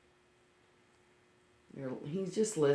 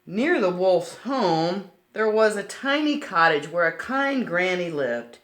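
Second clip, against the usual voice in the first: storybook narration; 3 semitones higher, 8 dB louder than usual. A narrator's reading. Reading a picture book aloud to little listeners, bright storybook voice. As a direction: read it like a picture book for children.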